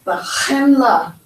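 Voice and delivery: slow BA CHENLA, throaty "kh"; Ba KHEN-la with a back-of-throat scrape; guttural ch. Shown as a demonstration. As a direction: say Ba chenla slowly, rasping the ch. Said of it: "Hear that ch?".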